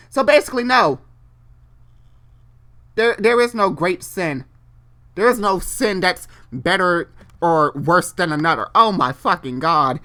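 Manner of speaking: loud high exasperated outburst. Real, not acted. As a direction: blurt it out, fed up.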